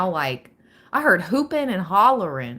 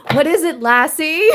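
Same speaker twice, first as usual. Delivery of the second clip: higher, louder call to a dog; mock Lassie call; 8 semitones higher, 8 dB louder than usual. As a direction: call out like to a loyal dog, playfully. Jokes calling spirit Lassie.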